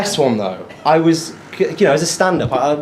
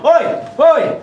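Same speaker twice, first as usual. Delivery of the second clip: high-pitched mock street call; calling out, pitched right up; shouting across the road; 10 semitones higher than usual. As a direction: imitate someone hailing a stranger from afar. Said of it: "someone shouting me from across the road".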